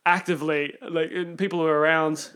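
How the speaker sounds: ordinary talk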